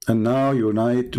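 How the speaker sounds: usual speaking voice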